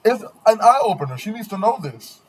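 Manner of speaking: high-pitched indignant rant. Real, not acted. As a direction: preach it, disgusted. Continues rant.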